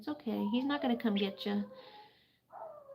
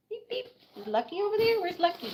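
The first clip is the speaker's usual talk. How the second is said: much higher, playful pet voice; high pet talk; 9 semitones higher than usual. Real, not acted. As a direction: ask the bird brightly up high. Baby talk to bird.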